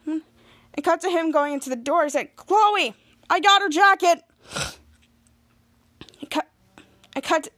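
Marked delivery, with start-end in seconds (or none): 2.4-4.2 high, loud call into the house. Retold or imitated. Jasper to Chloe inside the house, calling out. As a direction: holler it to someone indoors.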